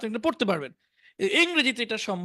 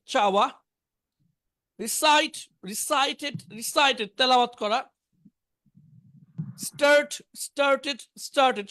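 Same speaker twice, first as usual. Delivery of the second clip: vocabulary drill; 2 semitones higher than usual. Shown as a demonstration. Teacher reciting verb forms to the class, measured drill delivery. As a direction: call out each verb form, then its meaning.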